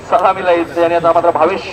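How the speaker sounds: ordinary talk, nothing marked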